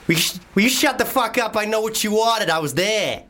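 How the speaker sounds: put-on American accent from Boston, high and indignant. Podcaster shows off his American accent on request, brash Boston. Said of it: "I'm gonna do an American accent".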